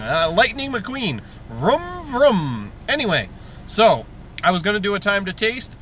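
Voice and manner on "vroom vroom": playful race car vroom; car engine vroom. Pretend. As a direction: rev it like a toy car. Lightning McQueen car noise.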